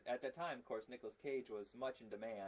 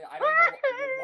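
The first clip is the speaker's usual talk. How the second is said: squeaky falsetto; 18 dB louder, slower than usual. Pretend. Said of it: "Why do I keep attempting it?".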